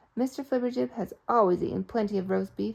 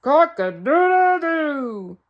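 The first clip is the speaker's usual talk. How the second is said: loud, slow, half-hearted crow; a man's clumsy attempt at crowing; man trying to crow; 5 semitones higher, 10 dB louder, much slower than usual. Shown as a demonstration. Mister Flibbertigibb trying to crow for the rooster, slowly. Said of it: "Listen to me try it".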